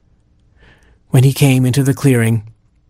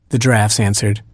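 usual speaking voice

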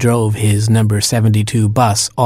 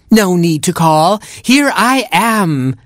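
high, slow, bouncy kangaroo voice; kangaroo doctor; 8 semitones higher, slower than usual. Acted. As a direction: sing it out high and drawn out.

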